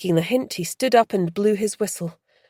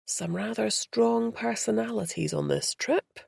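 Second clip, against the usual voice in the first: whispered aside; 4 semitones higher, 6 dB quieter than usual. Acted. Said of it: "he then whispered".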